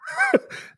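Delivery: high burst of laughter. Real, not acted. Host cracking up at guest's puppet, real laugh. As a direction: crack up at the joke.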